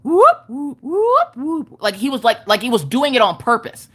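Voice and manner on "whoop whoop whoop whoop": mock cop siren chirps; siren whoops. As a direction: whoop like a squad car. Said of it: "the car just goes".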